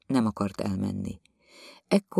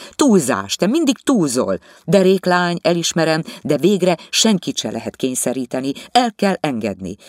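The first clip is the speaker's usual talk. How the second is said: louder, irritated husband; 6 semitones higher, 6 dB louder than usual. Acted. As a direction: argue her down. The husband argues. (ingerült férj, hangosabban)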